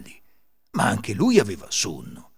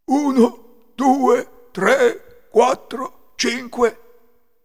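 higher, louder thief counting coins; 13 semitones higher, 8 dB louder, much slower than usual. Acted. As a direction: count the loot aloud, gruff and firm. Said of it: "Uno dei ladri cominciò". (il ladro conta i soldi ad alta voce)

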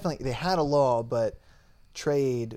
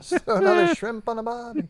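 high, loud hammed-up Australian accent; 11 semitones higher, 5 dB louder than usual. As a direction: squeal it in a cartoon Aussie accent.

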